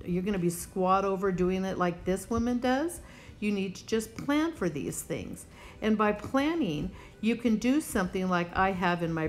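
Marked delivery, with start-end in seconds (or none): none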